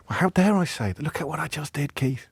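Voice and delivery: high, hushed mock horror; mock self-reproach. Presenter pretends to be appalled at what he just said, mock self-reproach. Mock outrage at own remark.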